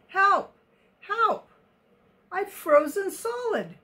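high-pitched, acted distress call; cry for help. A narrator's reading. Elmer calling to the playing elephants, a high cry for help.

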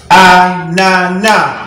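"ananas" slowly as a-na-na, final s silent; silent final letter. Shown as a demonstration. Teacher showing the silent ending of "ananas", slowly syllabified. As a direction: draw out "ananas", ending on an open a. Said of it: "On ne prononce pas ce qui est à la fin".

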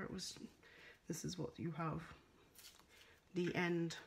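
ordinary talk

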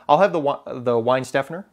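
Weinstephaner as WINE-STEF-en-er, English w and plain s; Americanized pronunciation. Retold or imitated. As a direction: order an Americanized Weinstephaner, rising at the end. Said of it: "I kind of Americanized it".

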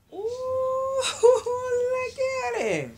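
high, loud, drawn-out squeal of delight. Real, not acted. Unboxing her new reborn baby boy, delighted squeal. First sight of the doll.

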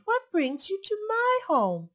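high, slow surprised question; exclaiming. Acted. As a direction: ask in glad surprise. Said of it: "Omu exclaimed".

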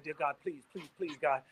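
soft pleading prayer; mock prayer. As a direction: beg God quietly. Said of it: "and I said".

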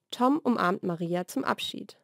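ordinary delivery

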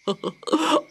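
a silly excited bird; emu laugh. A narrator's reading. Emu giggles to Dot, high. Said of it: "said the big bird".

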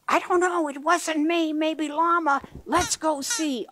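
high zebra voice; Zebra's voice. Acted. Zebra answers in book.

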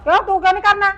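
angry demand. Acted. Mother confronts son.

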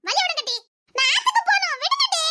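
high whiny plea to clear the way; whiny pleading. Acted. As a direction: beg them like a sulking girl. Girl begs friends to let her pass.

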